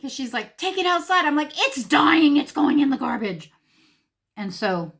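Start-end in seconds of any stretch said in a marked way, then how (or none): 0.6-1.2 high, loud retold command; raised, insistent voice; urgent order. 1.5-3.5 loud, high shouted verdict; emphatic refusal. Retold or imitated. Retold shouted refusal to daughter.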